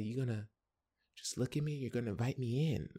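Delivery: soft, slightly lifted woman's voice; hushed flirty question; coy woman. Retold or imitated. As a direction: lightly voice her, quiet and teasing. Retold woman at the door.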